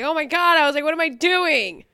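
loud, high mock panic; retold panic. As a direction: replay the freak-out on set.

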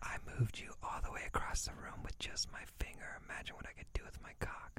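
soft sly whisper in the ear; whispered pickup line. Acted. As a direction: lean in and whisper it. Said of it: "I gotta whisper in your ear".

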